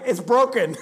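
loud, exasperated imitation of dad; frustrated dad. Dad giving up on the rifle scope, frustrated. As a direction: blurt it out as a fed-up dad, loudly. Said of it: "seen my dad get so frustrated".

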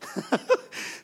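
short breathy chuckle; quick laugh at yourself; self-amused laugh. Real, not acted. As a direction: laugh at your own fumbled joke. Laughs at his botched joke.

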